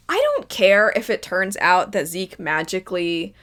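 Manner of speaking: ordinary talk, nothing marked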